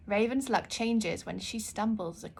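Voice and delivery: ordinary delivery, nothing marked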